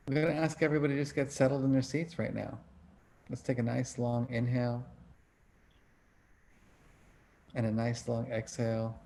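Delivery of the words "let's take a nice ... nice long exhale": guided breathing. Acted. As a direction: ease them into long breaths. Leads a breathing exercise.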